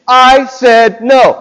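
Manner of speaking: slow, loud, firm and deliberate; stern warning. Retold or imitated. Parent walking up to child, stern slow warning. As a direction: lay down the law slowly. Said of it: "And you walk up and".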